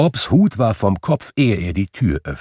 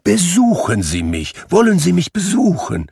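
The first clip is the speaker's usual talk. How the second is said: rising to much higher eager plea; eager invitation; 11 semitones higher, 3 dB louder than usual. Acted. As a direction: press the invitation warmly. Gentleman inviting.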